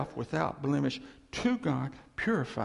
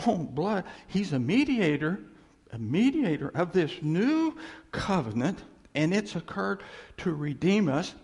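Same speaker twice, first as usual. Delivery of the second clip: high, loud, gushing with joy; excited praise; 4 semitones higher, 4 dB louder than usual. Pretend. Preacher showing the church how to get excited. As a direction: burst out in thrilled wonder. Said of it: "we really could get excited about it".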